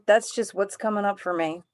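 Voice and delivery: normal talking voice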